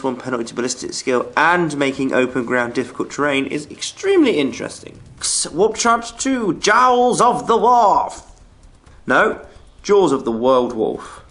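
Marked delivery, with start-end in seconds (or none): 5.5-8.3 slow halting misread; stumbling misread. Real, not acted. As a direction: trip over the words slowly. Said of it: "I'm good at reading".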